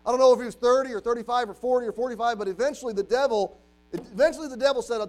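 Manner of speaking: high, loud, driving build; impassioned preaching. Real, not acted. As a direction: preach it hard toward the climax.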